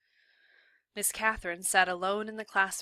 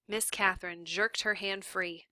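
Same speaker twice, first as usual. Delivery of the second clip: ordinary delivery, nothing marked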